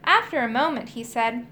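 ordinary delivery, nothing marked

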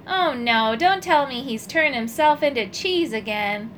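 usual speaking voice

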